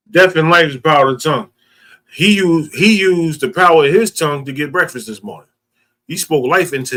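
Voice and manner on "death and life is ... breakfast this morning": loud, fast preacher proclaiming; preaching. Acted. Preacher proclaiming the scripture to the listeners. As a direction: preach it out to the congregation.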